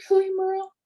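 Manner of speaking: high-pitched, deeply moved quote; retold tearful emotion. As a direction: imitate someone moved to tears, voice lifted high. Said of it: "And he was crying".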